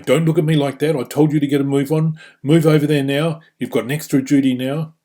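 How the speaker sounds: loud, high-pitched telling-off; heated, forceful reprimand; confrontational. Shown as a demonstration. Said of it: "that sort of confrontation".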